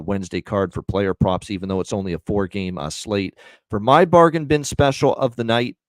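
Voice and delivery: normal talking voice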